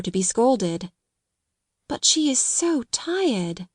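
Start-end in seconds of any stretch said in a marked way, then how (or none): 1.9-3.7 slow, high, tender thought; tender musing. A narrator's reading. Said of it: "she thought".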